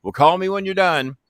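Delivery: hint of the pushy friend; voicing Jimmy. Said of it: "Jimmy insisting".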